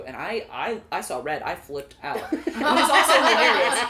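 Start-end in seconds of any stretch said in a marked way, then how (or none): 2.3-3.8 higher and louder, laughing through it; real laughter breaking out. Real, not acted. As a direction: crack up as you say it. Bursts out laughing retelling.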